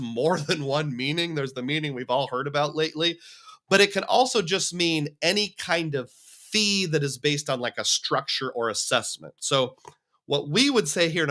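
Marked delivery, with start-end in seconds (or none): none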